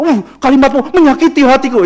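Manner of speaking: high, loud melodramatic hurt; mock wounded. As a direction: overact being deeply offended. Acted overblown hurt reply. (pura-pura tersinggung secara berlebihan)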